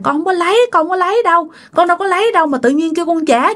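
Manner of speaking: put-on high pleading child, tumbling fast at the end; desperate denial. Child pleading with uncle not to make him pay. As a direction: beg to be believed, pitch up. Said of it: "bạn nói rằng".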